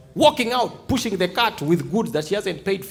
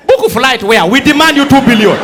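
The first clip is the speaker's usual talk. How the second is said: loud, bossy caller voice; mock shouted demand; 4 semitones higher, 9 dB louder than usual. Acted caller demands money.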